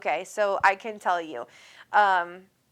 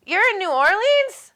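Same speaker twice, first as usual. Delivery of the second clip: surprised; 11 semitones higher, 9 dB louder than usual. Real, not acted. Surprised by viewer's location.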